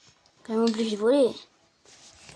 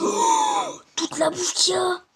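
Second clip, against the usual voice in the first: excited shout; 6 semitones higher, much slower than usual. Real, not acted. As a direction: yell with joy at the food. Shouting at the food found.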